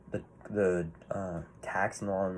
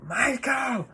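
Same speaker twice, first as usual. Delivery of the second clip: retold scream. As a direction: shriek the name like a desperate mother. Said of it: "She's just screaming for him".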